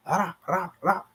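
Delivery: Ra, ra, ra said high and soft; ra drill. Shown as a demonstration. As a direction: softly repeat Ra, ra, ra for the class. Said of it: "You, you can't really separate 'em".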